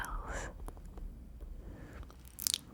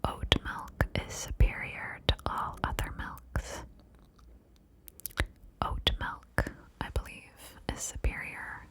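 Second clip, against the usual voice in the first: whisper in both ears. Shown as a demonstration. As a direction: close centred secret whisper. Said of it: "I'm going to say it in both of your ears".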